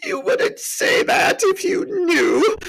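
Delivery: slow, loud, high-pitched wail; hammed-up tearful cry; between sobs. A narrator's reading. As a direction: blubber it out between gulps. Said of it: "replied Mister Tumnus between his sobs".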